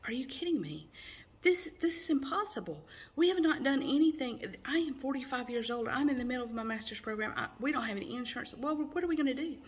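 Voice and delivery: high, rushed, disbelieving outburst; retold shocked disbelief. Telling the doctor she can't be pregnant, high shocked disbelief. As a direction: replay the panic of hearing the impossible news. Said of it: "And I was like".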